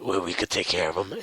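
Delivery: low dry vigilante; Batman voice. Retold or imitated. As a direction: keep it low and calm like Batman. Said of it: "Batman's like".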